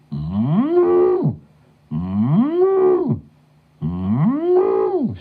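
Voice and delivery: high, long-drawn moo rising up; cow mooing. A narrator's reading. Stuck cow calling to passers-by, urgent moos.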